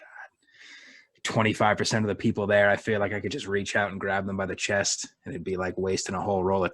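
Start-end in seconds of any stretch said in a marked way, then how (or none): 0.0-1.2 whispered, exasperated "God"; under your breath, fed up; barely audible whispered dismay; muttered disgust. Retold or imitated. Acting out private disgust at coworkers.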